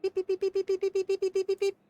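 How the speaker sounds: high rapid robot beeps; imitated robot beeps; robot beeping. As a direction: beep like a fleeing robot.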